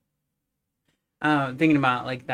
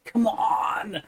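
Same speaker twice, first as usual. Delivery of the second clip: exasperated plea; 6 semitones higher, much slower than usual. Retold or imitated. Acted impatience with dragged-out show.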